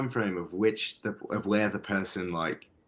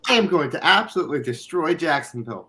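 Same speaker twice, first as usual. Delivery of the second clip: high, loud, mock-boastful outburst; hyped self-quote; 8 semitones higher, 4 dB louder than usual. Player hyping himself after high praise, high loud boasting. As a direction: crow it like an overexcited player. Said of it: "I'm like".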